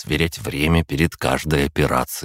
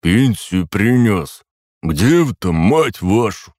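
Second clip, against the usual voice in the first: rough thug shout; 5 semitones higher, 5 dB louder, slower than usual. Acted.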